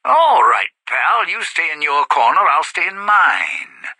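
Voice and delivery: loud keep-your-distance order; wary warning. Acted. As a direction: back someone off, loud and high. Warning a threatening stranger off.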